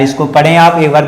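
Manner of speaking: usual speaking voice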